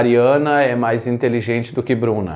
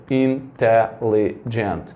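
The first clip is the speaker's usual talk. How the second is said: "Intelligent" said slowly as four separate beats; syllable by syllable; 2 semitones lower, much slower than usual. Shown as a demonstration. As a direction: break "Intelligent" into in-te-lli-gent. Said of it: "fazer aqui a contagem pelo som".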